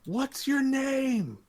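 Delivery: high, slow call-out; announcer calling out aside. Acted. Announcer with hand over mic asks the team, calling out. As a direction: call across to the team, words drawn out. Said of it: "he kinda calls out to you".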